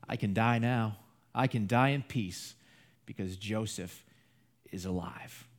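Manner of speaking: put-on an old father near tears, letting go; tearful relief. Old father reunited with son.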